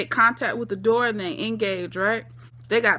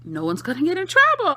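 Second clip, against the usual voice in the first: put-on high giddy meth girls; 5 semitones higher than usual. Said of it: "'cause they say".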